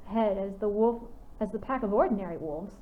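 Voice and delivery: ordinary delivery, nothing marked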